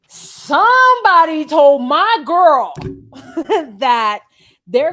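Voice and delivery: loud, slow, high mock-indignant call-out; retold confrontation. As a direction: boom it out like an offended boyfriend. Marshall confronting the house.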